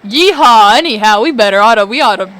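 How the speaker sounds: stumbling mock-southern accent, punched loud; bad southern accent. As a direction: overdo a fake southern cowboy twang. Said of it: "what's a southern accent?".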